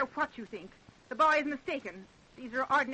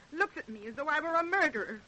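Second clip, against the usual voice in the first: normal talking voice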